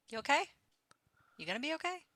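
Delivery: high, soft questioning; concerned check-in. Acted. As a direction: gently check on a companion, voice lifting high. Player checks on a shaken companion.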